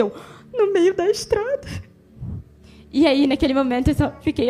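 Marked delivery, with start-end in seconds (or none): 0.5-1.7 high, slow question in disbelief; mock-shocked, drawn-out question rising high; incredulous echo question. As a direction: act out appalled disbelief, pitched up. Shocked at being left on road.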